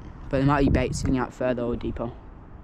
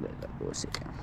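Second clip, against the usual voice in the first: whispered; 14 dB quieter than usual. Real, not acted. Waiting on a fish.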